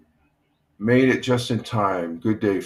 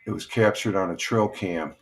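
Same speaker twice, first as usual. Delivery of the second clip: ordinary talk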